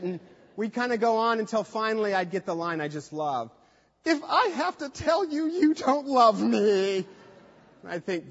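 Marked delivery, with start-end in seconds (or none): 4.0-7.1 higher, slower mock wounded girlfriend; hammed-up hurt girlfriend. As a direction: play her sulky guilt-trip line. Ex-girlfriend's line, mocked.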